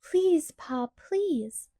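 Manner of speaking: put-on high, drawn-out childish please; pleading. A narrator's reading. Laura pleads with Pa, high childish begging. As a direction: beg as a little girl, rising high. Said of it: "Laura begged".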